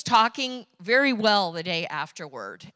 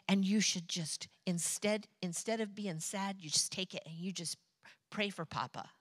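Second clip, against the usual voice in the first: much softer, coaxing and gentle; soft tender advice; 9 dB quieter than usual. Retold or imitated. Mother softly tells her daughter to pray, tender and hushed. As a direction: ease her sadness quietly. Comforting grieving young daughter.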